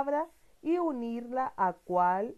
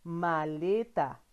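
slow, drawn out syllables; slow model word; 3 semitones lower, much slower than usual. Shown as a demonstration. Teacher to child, slow model word. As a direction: spell it out slowly in sound. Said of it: "Repite conmigo".

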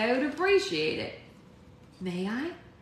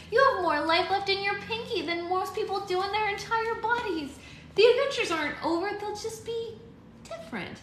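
put-on higher, earnest young heroine cheering her; young girl voice; 12 semitones higher, 4 dB louder than usual. A narrator's reading. Alex encouraging Mother Goose.

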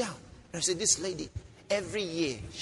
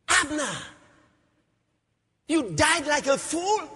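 high, drawn-out cry; preacher dramatizing a lament; anguished reproach; 11 semitones higher, 5 dB louder, slower than usual. Acted. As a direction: call out to the dead in disbelief. Acting David's outcry over Abner.

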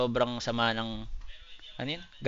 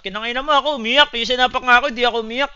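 loud protest; 10 semitones higher, 9 dB louder, faster than usual. Real, not acted. Loud disbelief at crying remark.

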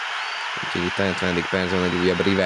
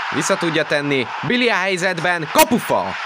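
excited sports commentary; 10 semitones higher than usual. Real, not acted. Chance and post in commentary.

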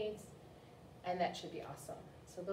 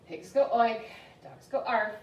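louder, insistent storybook mother; mother correcting; 3 semitones higher, 8 dB louder than usual. Acted. Mother correcting George's oink, insistent. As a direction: drill the puppy on animal sounds.

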